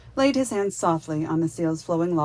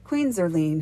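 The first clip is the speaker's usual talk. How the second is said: ordinary delivery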